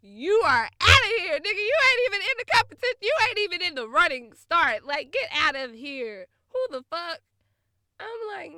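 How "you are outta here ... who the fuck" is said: high, loud, fast brush-off; loud dismissal. Retold or imitated. Telling the ex he is out, loud and high. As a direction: shout him out of the race.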